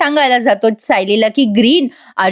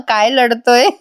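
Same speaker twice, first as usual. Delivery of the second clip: ordinary talk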